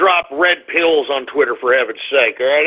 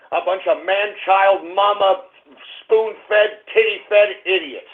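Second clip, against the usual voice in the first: ordinary delivery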